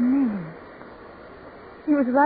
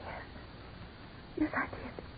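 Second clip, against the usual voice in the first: a frightened whisper; a wife admitting in fear that she felt it too; 20 dB quieter than usual. Acted. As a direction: breathe it out without voice. Fearful admission at night.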